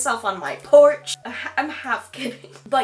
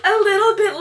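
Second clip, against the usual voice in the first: laughing singsong; 11 semitones higher, 7 dB louder than usual. Real, not acted. Laughing singsong about jealousy.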